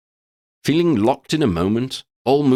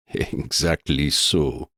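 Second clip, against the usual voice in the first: low, slow, with a light chuckle; chuckling beast; 6 semitones lower, much slower than usual. A narrator's reading. The beast agrees with a low chuckle. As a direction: let a deep chuckle through it. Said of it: "the beast chuckled".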